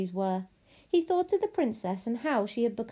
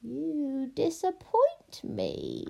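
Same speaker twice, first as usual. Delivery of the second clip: high, very slow witch drawl; disappointed witch; 5 semitones higher, much slower than usual. Acted. Witch judges the loveless princess, ominously disappointed. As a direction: stretch the judgement out, rising.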